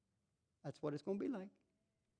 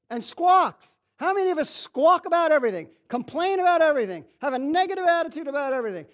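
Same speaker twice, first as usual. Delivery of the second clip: loud, high preacher's cry; full-force preaching; 10 semitones higher, 13 dB louder than usual. Acted. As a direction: preach it hard to the congregation.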